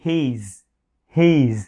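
"he's" as one long syllable ending in z; slow, drawn-out "he's"; contracted he's. Shown as a demonstration. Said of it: "viết tắt là he's".

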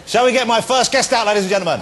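rally the crowd for the first guest; loud host hype; rousing call to audience. Real, not acted.

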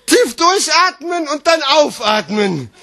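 slow, high start gliding down; exclaimed relief. Acted. Slam poet breathing again in his text, exclaimed relief. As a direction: let it out like a huge sigh of relief. Poem reaches its big relief.

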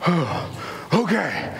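acting exhausted from lifting a child; worn-out adult. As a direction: give in between tired breaths.

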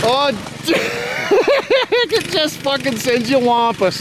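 talking through a high laugh; laughing remark. Real, not acted. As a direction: crack up mid-sentence, then carry on. Laughing at the bike's behaviour.

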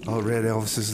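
ordinary talk, nothing marked

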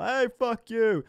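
high, slow mock shout; imagined heckle. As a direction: holler a drawn-out pretend insult. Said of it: "you can't just sell".

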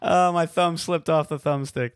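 usual speaking voice

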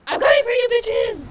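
high, loud taunting yell; playful shout. Real, not acted. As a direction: call it out to the hiders. Seeker starts the hunt.

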